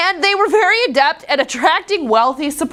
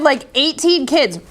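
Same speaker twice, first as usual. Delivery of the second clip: normal talking voice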